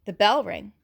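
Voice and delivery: ordinary delivery, nothing marked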